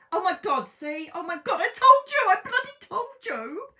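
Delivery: shrieking high and loud with delight; thrilled shout. Real, not acted. Tarot reader shrieking at her viewers, thrilled loud shout. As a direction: yell it out, over the moon. Reading comes true, thrilled.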